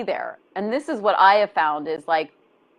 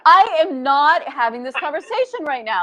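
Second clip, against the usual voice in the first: exasperated retort; 7 semitones higher than usual. Acted. Answering the inner critical voice.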